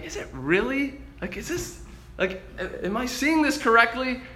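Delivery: hushed, hesitant, half-formed questions; wondering. Retold or imitated. Disciples at breakfast with the risen Lord, hushed wondering. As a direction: wonder aloud softly, rising at the end. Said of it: "you know they were wondering".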